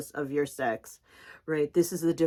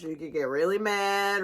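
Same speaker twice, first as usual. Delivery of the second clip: ordinary talk, nothing marked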